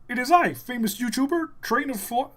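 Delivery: high puppet frog voice; grand introduction as a high-voiced frog puppet; Kermit. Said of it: "makes you do the voice".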